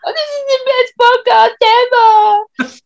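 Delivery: very high, loud fangirl wail; hammed-up imitation of an overwhelmed fan; mock gushing excitement. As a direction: squeal it slowly, as if overcome with joy. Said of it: "She was fully immersed".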